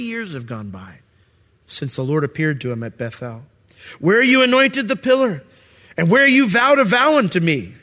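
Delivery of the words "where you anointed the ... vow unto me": God reminding. Retold or imitated. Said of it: "he reminds him in verse thirteen".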